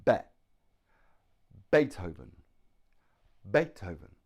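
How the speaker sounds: Beethoven with a loud, punched BE and the rest falling away; stress on first syllable. Shown as a demonstration. English teacher demonstrates the name, Beethoven stressed firmly on its first syllable. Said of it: "stress is on the first syllable".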